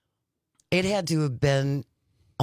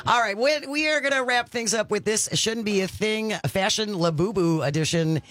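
upbeat, higher radio announcer read; radio host voice; 6 semitones higher than usual. Acted. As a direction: sell the segment break like a DJ. Host reads show outro.

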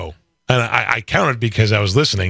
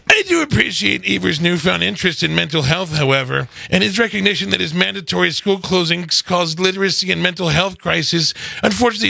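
raised-pitch mock politician reading; senator impression; 4 semitones higher than usual. Co-host reads on as the senator, raised mocking voice. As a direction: read it as a caricatured senator, pitched up. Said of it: "let's read on with, with, with".

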